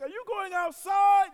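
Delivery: high, slow, incredulous yell; mock alarmed shout. Wife to husband heading out into danger, mock alarmed shout. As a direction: shout it in hammed-up disbelief.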